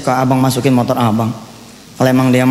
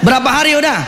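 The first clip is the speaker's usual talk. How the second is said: calling out; 9 semitones higher than usual. Real, not acted. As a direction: shout the question to the crowd. Preacher calls question to audience.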